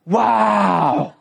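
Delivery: long drawn-out, full-voiced "wow"; stretched, mock-amazed exclamation; astonished wow. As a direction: act out being struck with amazement. Acted astonishment at others' accents.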